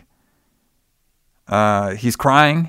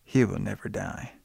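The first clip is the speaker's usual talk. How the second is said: ominous hush; 4 semitones lower, 12 dB quieter than usual. Retold or imitated. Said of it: "Jamie's like".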